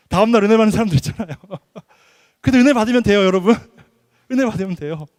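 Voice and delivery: loud, quick words through chuckles; laughing. Real, not acted. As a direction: joke with the congregation, laughing as you go. Preacher joking with congregation, laughing.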